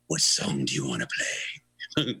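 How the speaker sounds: low-pitched rock-star imitation. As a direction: ask it as the tipsy singer. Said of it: "looks up with me and says".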